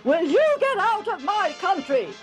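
high, furious royal bellow; angry roar. Acted. King Abimelech ordering Isaac's people out, roaring. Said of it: "he roared".

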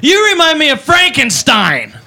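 put-on slow, slurry drunk taunt; drunk girl insult. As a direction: sneer it like a tipsy partier. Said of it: "She goes".

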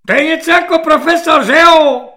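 high, loud barking drill corporal; screeching corporal. Acted. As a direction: yell it at the recruit like a shrill petty corporal. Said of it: "ječivým hlasem svobodníka".

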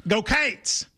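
"Go Cates" stretched into two syllables, Kentucky style. Shown as a demonstration. Kentucky host showing the real cheer, "Go Cates". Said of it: "in Kentucky, we go".

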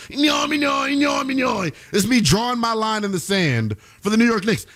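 hammed-up cartoon doodle monster shout; Doodle Bob impression. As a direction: bellow it like a scribbled cartoon brute. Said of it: "do my best Doodle Bob impersonation".

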